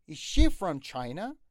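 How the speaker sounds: slow question, "China" stressed on its opening "Chi". Shown as a demonstration. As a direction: lean on the "Ch" of "China", rising at the end. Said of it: "رو سی اچ هست استرس".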